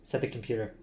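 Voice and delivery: ordinary delivery, nothing marked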